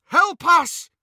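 high, loud frantic yell; desperate yell. Acted. Trapped Harry yells at the mirror, desperate loud cry. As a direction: shout for help in mad desperation. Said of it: "he yelled at it in mad desperation".

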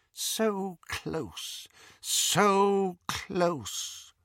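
high, hushed dark-lord musing; eerie whisper-like. A narrator's reading. Voldemort musing to himself, hushed and high. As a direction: savour it like a predator nearing prey. Voldemort's thoughts in Harry's mind.

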